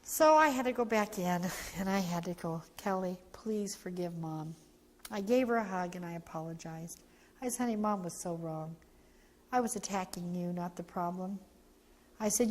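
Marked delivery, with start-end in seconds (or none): 2.8-4.5 soft, slow, low plea for forgiveness; tender apology. Retold or imitated. Mother apologizes to daughter. 7.6-11.4 gentle apology. Retold or imitated. Mother apologizes softly.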